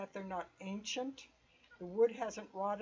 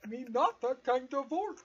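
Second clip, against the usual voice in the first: dumb orc voice; 5 semitones higher, 8 dB louder than usual. Retold or imitated. GM repeats orc line laughing.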